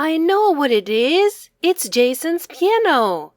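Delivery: put-on eager boy shouting his answer to his friend, fast and high; excited cry. A narrator's reading. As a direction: blurt it out like a kid who just solved it. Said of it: "cried Max excitedly".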